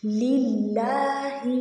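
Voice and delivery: للہ with the final ha kept short, not stretched. Shown as a demonstration. Teacher shows students the short ha. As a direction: say للہ slowly, ha unstretched. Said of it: "ہا کو بغیر کھینچے پڑھیں گے".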